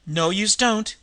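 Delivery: put-on a high, loud tough-girl cry; sudden scream. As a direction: scream it to stop her at the door. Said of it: "voice rose to a sudden scream".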